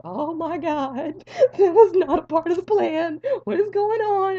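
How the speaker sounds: very high, loud mock wail; hammed-up panic. Patient finding blood in his hand, hammed-up panic. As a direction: cry out in squealing, exaggerated horror.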